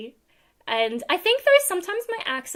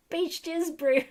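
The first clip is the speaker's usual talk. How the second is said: put-on New Zealand accent; 5 semitones higher, slower than usual. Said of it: "a New Zealand accent".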